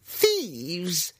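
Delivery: high, outraged cry of a crazed hermit. Acted. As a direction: sound the alarm in a high voice. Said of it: "the human cried".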